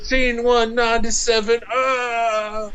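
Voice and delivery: mock dying shout. Chris Chan dying, acted cry.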